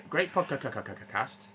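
stuck stuttering on "podcast" before "cast"; glitchy stutter. Real, not acted. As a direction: repeat the first syllable of "podcast" like a glitch, then "cast". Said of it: "There was just a glitch in the Matrix".

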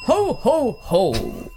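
put-on Santa ho-ho-ho, falling at the end; jolly Santa. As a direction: laugh out ho ho ho like Santa. Plays Santa in an improvised scene.

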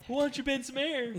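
high-pitched Airbender parody; hammed-up cheesy one-liner; mock movie hero. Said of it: "He's like".

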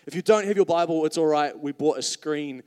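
ordinary talk, nothing marked

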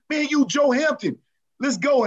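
high-pitched pep talk to a teammate; retold hyping up. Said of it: "we were going off and blowing him up".

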